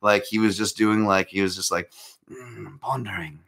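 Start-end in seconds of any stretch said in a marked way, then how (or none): 2.3-3.4 hushed, mock-English boxer musing; soft, hammed-up British accent; terrible English accent. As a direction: put on a bad English accent, pondering. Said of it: "trying to do a terrible accent".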